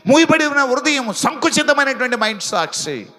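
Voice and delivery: rising, ringing preacher's cadence that swells on each appeal; full-force preaching. Real, not acted. Pastor exhorting his congregation, fervent full-force preaching. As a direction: preach it out, pushing every line to the crowd.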